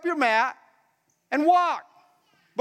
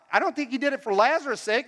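ordinary delivery

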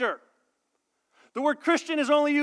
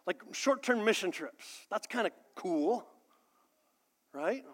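hesitant reluctant voice; 6 dB quieter than usual. Retold or imitated. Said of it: "non-touchy-feely people are like".

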